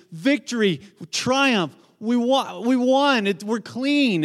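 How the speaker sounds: high, triumphant cries; exultant crowd. Acted. Voicing the celebrating crowd.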